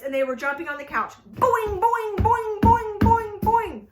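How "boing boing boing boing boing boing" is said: high, loud bouncing boings; boing sounds. Acted. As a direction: bounce each boing like a spring. Goats jumping on couch.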